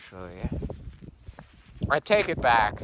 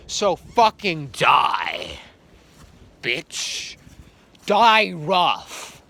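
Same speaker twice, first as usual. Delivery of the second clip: angry; 3 semitones higher, 6 dB louder, much slower than usual. Acted. Hostile outburst at a rival.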